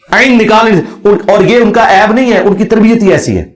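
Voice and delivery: ordinary talk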